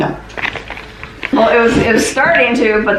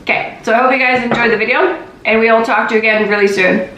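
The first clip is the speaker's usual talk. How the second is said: normal talking voice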